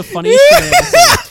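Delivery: high, loud laughing words; crying with laughter. Real, not acted. Friend recalls the funniest film moment, high and loud, crying with laughter.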